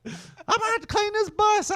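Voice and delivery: high whiny driver voice; whiny bus driver. Retold or imitated. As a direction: whine it as the driver.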